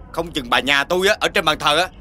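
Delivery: normal talking voice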